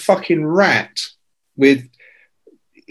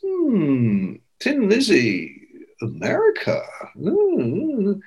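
slow, high musing with long hums; dim, drawn-out pondering; slow on the uptake; 6 semitones higher, 5 dB quieter, much slower than usual. Retold or imitated. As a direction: act out the penny very slowly dropping. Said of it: "so thick and slow as I am".

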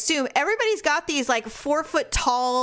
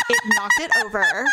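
much higher and louder through laughter; laughing; 4 dB louder than usual. Real, not acted. Friend laughing while telling her embarrassing moment, high squeal.

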